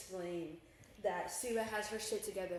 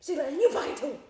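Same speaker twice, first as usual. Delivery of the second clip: imitated mother yelling, higher and louder; mock scolding mom; 6 semitones higher, 12 dB louder than usual. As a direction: blame the friend too, mock outrage. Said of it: "if I get in trouble and Sela's there".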